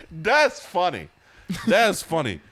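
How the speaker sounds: laughing. Real, not acted. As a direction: say it still laughing hard. Laughing at joke.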